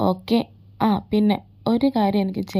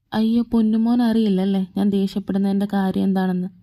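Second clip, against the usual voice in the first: ordinary talk, nothing marked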